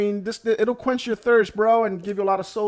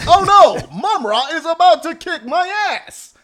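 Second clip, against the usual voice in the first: high, loud cartoon alarm; hammed-up, high-pitched cartoon panic; cartoon hero exposition; 10 semitones higher, 7 dB louder, slower than usual. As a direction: explain the peril like an old cartoon. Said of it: "one of those Thundercat cartoons".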